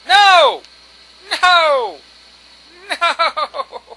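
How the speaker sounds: loud, high cries of no; exasperated repeated outburst; frustrated shouting. Real, not acted. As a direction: yell in despair at the game. Failing again in the game.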